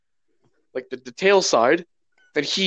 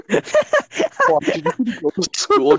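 high squeaky exclamation; 9 semitones higher, slower than usual. Real, not acted.

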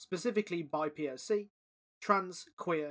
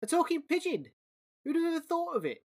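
much higher, squeaky amazed rat; delighted rat; 11 semitones higher, 5 dB louder than usual. Acted. Rat marvelling at the talking pigeon, very high and squeaky. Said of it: "said the rat".

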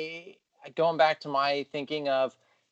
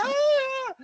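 very high, thin yelp of joy; joyful squeal; 3 dB louder than usual. Retold or imitated. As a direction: squeal like a giddy kid. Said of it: "he's jumping up for joy".

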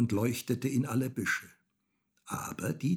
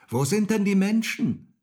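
put-on high, loud child's question; calling up; 12 semitones higher, 8 dB louder than usual. A narrator's reading. Häwelmann asks the rooster on the tower, calling up. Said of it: "rief der kleine Häwelmann hinauf".

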